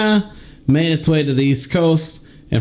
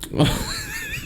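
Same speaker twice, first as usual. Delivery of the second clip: horse whinny; 9 dB quieter than usual. Pretend. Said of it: "Give me your best horse impression".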